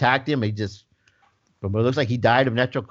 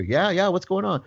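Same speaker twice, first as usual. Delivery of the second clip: lightly voiced impatient dad, pitched up; dad on speakerphone; 6 semitones higher than usual. Dad answering son's two a.m. call, impatient dad. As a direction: play the father answering his son's late-night call, higher. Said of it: "hear the dad through the speaker".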